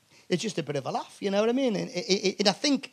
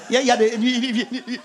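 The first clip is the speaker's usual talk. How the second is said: ordinary delivery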